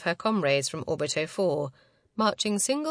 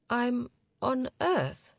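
slow, higher, halting mumble; thick mumble; 6 semitones higher, much slower than usual. A narrator's reading. Woman just woken takes in being on Earth, mumbling thickly. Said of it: "she mumbled, voice thick".

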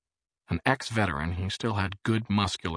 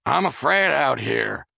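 slow, loud, higher yell; 7 semitones higher, 8 dB louder, slower than usual. A narrator's reading. Charles yells through the bathroom door, scared. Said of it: "evidently yelling loud".

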